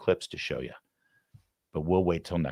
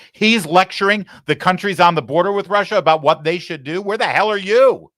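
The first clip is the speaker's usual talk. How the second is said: angry outburst; 11 semitones higher, 8 dB louder than usual. Real, not acted. Host erupts at Biden lecturing countries.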